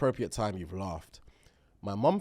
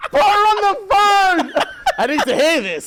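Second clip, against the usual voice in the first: loud mock shout; 13 dB louder than usual. Laughing, mock-shouting the request.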